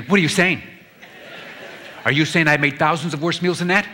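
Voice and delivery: offended wife snapping back at her husband, mock-indignant. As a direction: play her hurt outrage for laughs.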